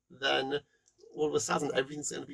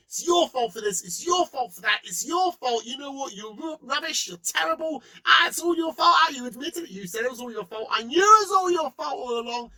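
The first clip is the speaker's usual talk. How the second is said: higher and louder, jabbing at your; mock blaming; 8 semitones higher, 6 dB louder than usual. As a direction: pile on the blame, half shouting. Acting out blame piled on.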